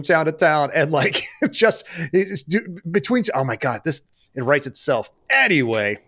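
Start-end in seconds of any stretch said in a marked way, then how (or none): none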